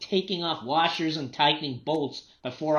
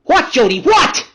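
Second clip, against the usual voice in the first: loud, high, snapping shout; irritated shout; 13 semitones higher, 15 dB louder, slower than usual. Acted. Distracted father yells at daughter Jody, sharp angry shout. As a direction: bark back at the interrupting child.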